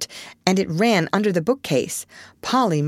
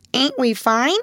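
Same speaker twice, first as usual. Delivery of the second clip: high squawky mimic voice; parrot mimicking Amy; 7 semitones higher than usual. A narrator's reading. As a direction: mimic a fine lady like a parrot. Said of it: "imitating her as well as he could".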